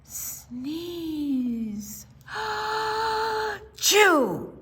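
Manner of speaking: hammed-up sneezing dragon; big dragon sneeze. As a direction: wind up high and let the sneeze burst out. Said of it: "he blew a great sneeze".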